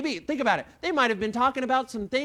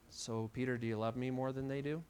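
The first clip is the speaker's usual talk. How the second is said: hushed Jesus; 9 semitones lower, 12 dB quieter than usual. Acted. Jesus quietly asks Peter.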